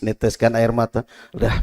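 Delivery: ordinary talk, nothing marked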